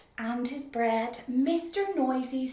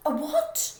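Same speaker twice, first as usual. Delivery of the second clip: puzzled; 5 semitones higher, 8 dB louder than usual. Acted. Baker can't hear.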